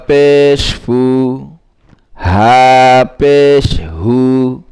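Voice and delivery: measured, chanting recital of spelled syllables; recitation drill. Shown as a demonstration. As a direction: read each syllable out slowly like a teacher drilling a class. (ধীরে ধীরে সুর করে বানান পড়া)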